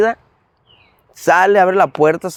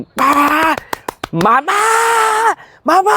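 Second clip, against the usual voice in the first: desperate shouting; 14 semitones higher, much slower than usual. Retold or imitated. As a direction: yell for mom in a panic. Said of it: "Y grite y grite, llorando, desesperado". (grito desesperado llamando a mamá)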